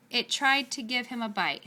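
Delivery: ordinary delivery, nothing marked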